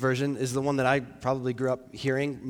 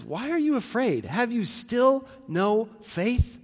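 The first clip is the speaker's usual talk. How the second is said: higher-pitched, challenging questions, slowing at the end; exasperated reproof, drawn out at the end; rebuke; 6 semitones higher, slower than usual. Acted. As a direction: press each word of the reproach. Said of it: "he's not done with rebuking".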